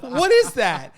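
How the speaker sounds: baffled at the cat; startled. Retold or imitated. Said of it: "I'm like".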